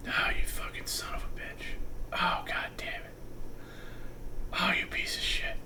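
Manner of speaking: whispered sleepy cursing; imitating a sleep-talker's whispered swearing; mumbled sleep talk. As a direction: mutter it like talking in your sleep. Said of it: "hear him mumbling in his sleep".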